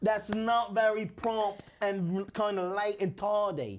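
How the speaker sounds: put-on slow comic English accent. Front desk grumbling about tardy ghosts, English accent. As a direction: stretch out the mock-Cockney complaint. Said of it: "I love doing the English accent".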